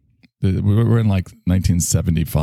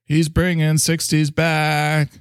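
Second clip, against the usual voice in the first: put-on awestruck kids voice; 9 semitones higher, slower than usual. Kids praising hip dad.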